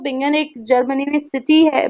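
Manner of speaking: normal talking voice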